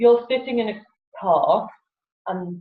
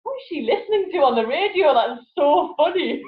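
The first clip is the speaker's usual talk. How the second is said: higher, amused bystander voice; mock curious drivers; 8 semitones higher, 3 dB louder than usual. Other drivers guessing what she hears on the radio, amused and higher. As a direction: wonder aloud what's on her radio. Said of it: "And they're like".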